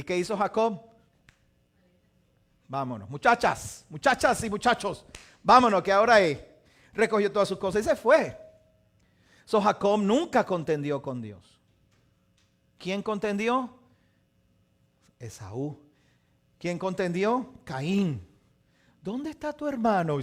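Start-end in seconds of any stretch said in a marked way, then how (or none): 2.7-6.4 loud, eager call to go; rally the whole household; calling out to family. Retold or imitated. Jacob calling his family to pack up and leave, rising to a loud call. 19.0-20.2 stern God questioning. Retold or imitated.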